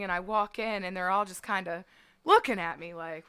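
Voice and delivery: ordinary delivery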